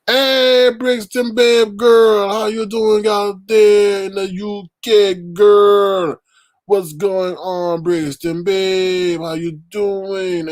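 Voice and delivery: loud, high, slow call-out; excited greeting. Real, not acted. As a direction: stretch the greeting out to a far-off friend. Greeting a chat viewer.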